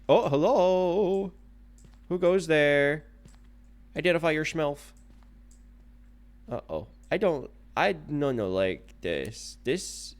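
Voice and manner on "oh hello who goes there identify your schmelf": high, slow mock challenge; hammed-up sentry calling out; mock guard voice. Challenges the ghost jokingly.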